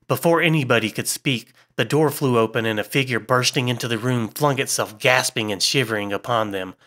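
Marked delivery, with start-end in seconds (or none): none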